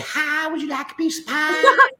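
Southern Kentucky accent, hammed-up and bright. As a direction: call it out like a cheery Kentucky hostess, way up high. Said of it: "my wife's from Kentucky, so she would say".

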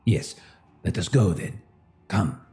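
usual speaking voice

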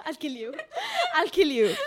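playful threat through laughter; teasing, laughed-out menace; laughing mock threat. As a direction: threaten a friend jokingly, giggling. Joking threat to friend, laughing.

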